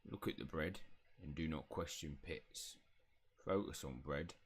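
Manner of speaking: low house elf giving instructions; Pitts. Acted. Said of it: "elf voice".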